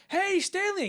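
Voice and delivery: very high, loud holler of greeting; calling out. Acted. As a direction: shout to someone off in the distance. Acted calling out to Stanley.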